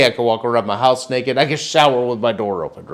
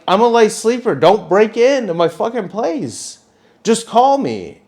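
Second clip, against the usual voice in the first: high, insistent complaint; exasperated pleading; 9 semitones higher than usual. Retold or imitated. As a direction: retell a fed-up telling-off. Retold scolding continues.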